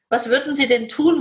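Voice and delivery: ordinary talk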